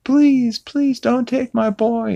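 high, mock-desperate begging; high tearful-sounding plea; pleading Peter. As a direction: beg God for his son, hammed-up. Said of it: "he's like".

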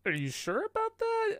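high doubtful question; skeptical instructor. Retold or imitated. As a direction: sound unconvinced. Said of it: "they were like".

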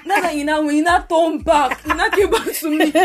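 high, loud retelling through laughter; laughing retort. Retold or imitated. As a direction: laugh while acting out the comeback. Retold insult exchange, amid laughter.

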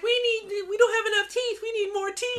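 put-on very high childish voice; high kid voice. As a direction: squeak it like a small boy. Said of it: "the kid's like".

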